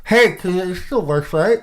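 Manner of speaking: talking around a pen; words round a pen held in the mouth. Real, not acted. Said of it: "I'll put my pen in my mouth".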